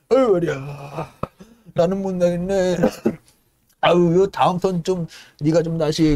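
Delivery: put-on slow, tired old man; old stoker Paddy handing his turn to a mate, slow and weary. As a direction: drag it out, worn out.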